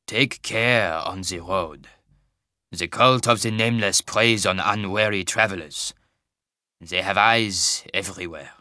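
put-on slow, heavy Tarbanese accent; thick Tarbanese accent. Wagon master warning a traveller, in a thick Tarbanese accent. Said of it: "in a thick Tarbanese accent".

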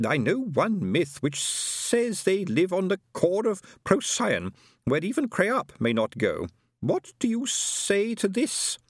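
high, slow, drawn-out character voice; pointedly projected. A narrator's reading. Herr Schugen challenging with a myth, pointedly, high and slow. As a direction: stretch the words out high, as if meant to be overheard. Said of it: "In a voice slightly louder than necessary".

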